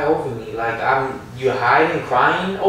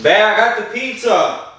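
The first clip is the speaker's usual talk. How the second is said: higher, louder announcement; calling out; 4 semitones higher, 4 dB louder than usual. Real, not acted. Boyfriend arrives with the pizza, calling out. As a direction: call it out to your partner.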